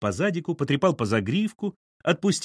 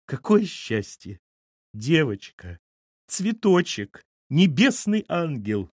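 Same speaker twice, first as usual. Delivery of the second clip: slow, tearful rapture; moaning with happiness; 5 semitones higher, slower than usual. A narrator's reading. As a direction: gush slowly, overcome with joy. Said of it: "простонала Валентина". (умилённо постанывая)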